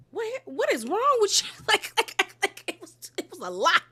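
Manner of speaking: high-pitched words broken by laughter; real giggling, voice way up; laughing disbelief. Real, not acted. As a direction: crack up while asking in disbelief.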